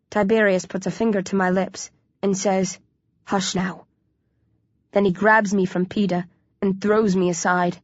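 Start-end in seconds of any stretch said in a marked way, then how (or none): 3.2-3.8 hushed, softly spoken order; quiet them gently. A narrator's reading. Tiberius silencing the hero, hushing softly.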